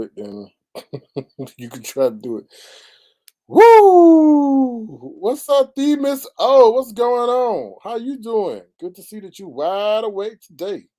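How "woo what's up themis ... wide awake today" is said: high, loud whoop of a hello; excited greeting. Real, not acted. Streamer welcoming a viewer in chat, high excited greeting. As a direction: greet the viewer like a long-lost friend.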